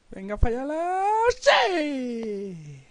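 high excited shout; excited yell. Real, not acted. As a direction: yell out in triumph. Final penalty, excited yell.

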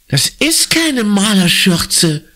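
high, slow breathless protest; gasping. A narrator's reading. Conny gasps at Marco over her ruined apron. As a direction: gasp the words out in dismay. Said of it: "japst Conny".

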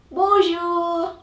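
high bright bunny hello; Lapin's greeting. Acted. Rabbit greeting the listener, high and chirpy. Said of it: "he says".

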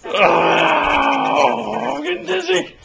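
high, loud joyful whoops while spinning; excited whooping. Real, not acted. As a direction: whoop it up, getting dizzy. Dad spinning toddler, whooping.

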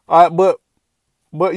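ordinary delivery

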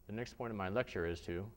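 swallowed, back-of-throat delivery; throat-held voice. Shown as a demonstration. Teacher demonstrating a closed-throat lecture voice. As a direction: keep the sound trapped behind the soft palate. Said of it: "I didn't even, like, increase dynamics".

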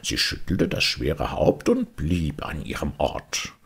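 ordinary delivery, nothing marked

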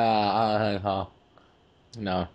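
ordinary talk, nothing marked

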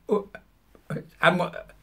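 ordinary talk, nothing marked

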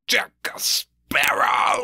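slow, breathy, lightly voiced sea captain's drawn-out call; a hint of Davy Jones calling Jack Sparrow; Davy Jones impression. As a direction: drag out the name like a cursed captain. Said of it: "The way he says Sparrow".

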